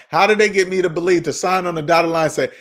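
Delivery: ordinary delivery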